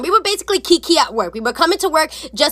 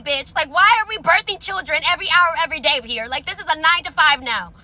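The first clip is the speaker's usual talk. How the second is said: ordinary talk, nothing marked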